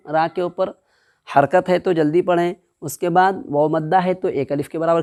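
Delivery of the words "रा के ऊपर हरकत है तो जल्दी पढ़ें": ordinary delivery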